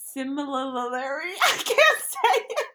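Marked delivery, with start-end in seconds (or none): 0.1-1.3 similarly slow, with an extra la syllable; tongue-tied. Shown as a demonstration. Fumbling the word, flustered and high. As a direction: stumble through similarly, extra L added. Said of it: "fumbling the word again".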